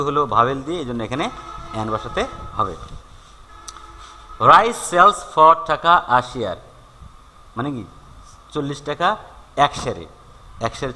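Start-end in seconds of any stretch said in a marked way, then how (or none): 4.5-6.6 slow, deliberate dictation of the sentence; slow example read. Shown as a demonstration. Teacher reading an example to students, slowly. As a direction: read it out word by word. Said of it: "teacher reads example sentence slowly".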